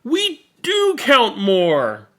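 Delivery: slow joyous cheer. Acted. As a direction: celebrate together. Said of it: "they cheered".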